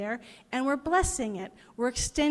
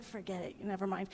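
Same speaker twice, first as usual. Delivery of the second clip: much softer, lower giving up; dejected mutter; 4 semitones lower, 11 dB quieter than usual. Retold or imitated. Car owner gives up on the priest, muttering softly. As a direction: let it drop away deflated.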